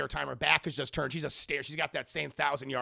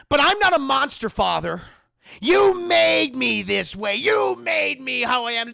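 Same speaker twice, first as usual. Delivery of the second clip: loud, high melodramatic shout; accusing son; 8 semitones higher, 11 dB louder than usual. Retold or imitated. Acted quarrel, son accuses father.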